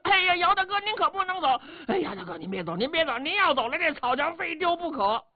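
high, urgent begging, then dropping and climbing; a chorus of soldiers begging him to stay; anxious run-on pleading. Acted. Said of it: "这兵全都说上话了".

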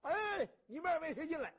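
calling out. Acted. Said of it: "在那招呼".

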